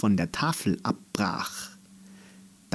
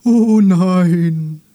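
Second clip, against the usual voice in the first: hoch und laut klagendes „Oh nein!“; jammervoller Baum; 8 semitones higher, 10 dB louder than usual. Acted. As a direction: wie ein leidender alter Baum jammern. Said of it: "mit einem jammervollen". (der Baum jammert laut über das Schaben des Horns)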